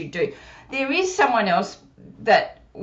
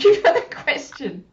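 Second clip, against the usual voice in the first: put-on puppet child voice; 10 semitones higher than usual. Puppet Susie thanks host.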